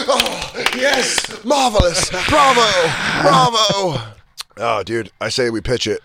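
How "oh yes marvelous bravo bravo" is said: high, hammed-up praise; exaggerated cries of admiration; mock connoisseur. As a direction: gush like a theatre snob. Mock audience praising the song.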